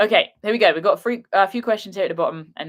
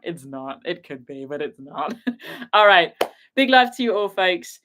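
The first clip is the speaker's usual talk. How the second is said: ordinary talk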